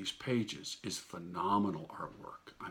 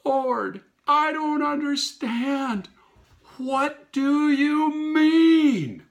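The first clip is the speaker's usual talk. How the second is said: much higher, loud and slow anguished cry; 13 dB louder, much slower than usual. Retold or imitated. Begging the Lord to explain, loud slow cry. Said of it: "I cried out and I said".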